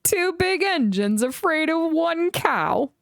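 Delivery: high, slow mocking storybook voice; teasing engine voice. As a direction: tease the big engines lightly.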